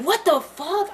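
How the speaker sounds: sudden high shocked cry; startled swear; shocked outburst. Retold or imitated. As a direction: blurt it out in disbelief. Retold waking up shocked.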